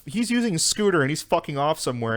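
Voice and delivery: normal talking voice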